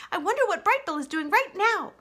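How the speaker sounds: high, bright-eyed little chatterbox; high, wistful young critter; chatty young opossum. Acted. Chit-Chat character voice.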